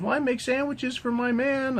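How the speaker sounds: high, mocking woman's voice; prissy falsetto imitation. Sandwich-making woman answering him, high mock voice. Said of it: "She goes".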